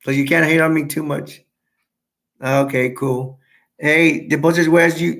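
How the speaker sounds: ordinary talk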